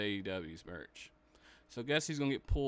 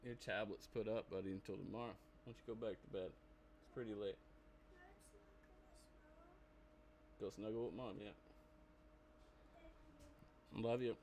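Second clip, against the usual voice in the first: soft, gentle, hushed bedtime voice; 13 dB quieter, faster than usual. Real, not acted. Sending his son to bed.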